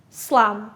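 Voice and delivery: teacher modelling slum; model word. Shown as a demonstration. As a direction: clear short slum. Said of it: "Repeat after me".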